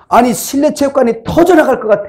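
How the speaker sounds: the wife's exclamation about the deafening sound; exclaiming wife. Retold or imitated. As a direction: exclaim it like his wife, amazed at the noise. Wife complains about the volume.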